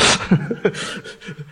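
quiet wheezy chuckle trailing on after the remark; real breathy laugh. Real, not acted. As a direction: laugh softly at your own slip. Laughs after the word mix-up.

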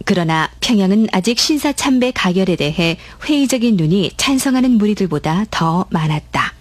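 ordinary delivery

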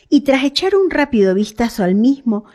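ordinary delivery, nothing marked

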